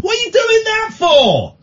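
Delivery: high, drawn-out yell; shouting out the window. Retold or imitated. Driver repeating his challenge to a distant man, shouting. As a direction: holler it across seventy yards. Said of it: "I started shouting out of the window".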